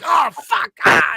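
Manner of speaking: loud, high despairing oh fuck; mock anguished yell. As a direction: howl like a fan watching their team lose. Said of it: "And they're like".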